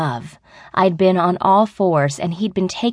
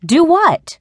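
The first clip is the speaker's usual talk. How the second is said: much higher, louder question ending in a fall; exasperated; 11 semitones higher, 6 dB louder than usual. A narrator's reading. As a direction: echo it high with exasperation. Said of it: "Anna sighed".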